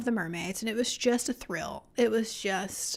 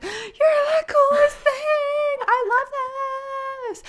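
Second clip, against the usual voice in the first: gushing; 7 dB louder than usual. Retold or imitated. Telling of fangirling over her friend's turtle facts, squealing gush. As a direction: gush at top pitch, stretching every word.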